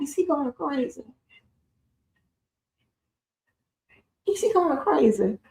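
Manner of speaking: high, hushed mock-incredulous question; amused disbelief. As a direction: ask it small and high, stifling amusement. Retold private aside while muted, amused.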